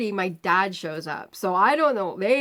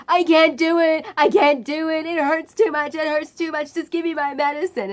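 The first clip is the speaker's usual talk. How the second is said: loud, high whining wail; the screamer demanding his medicine; screaming patient; 7 semitones higher, 6 dB louder than usual. Retold or imitated. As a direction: wail it like a tantrum. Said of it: "And he's like".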